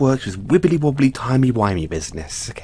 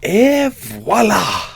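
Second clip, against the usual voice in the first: slow, drawn-out showman's flourish; grand reveal; 8 semitones higher, 5 dB louder, much slower than usual. Acted. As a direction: unveil it like a stage magician. Unveiling the time machine grandly.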